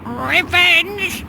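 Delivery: shrill squawking parrot cry. Acted.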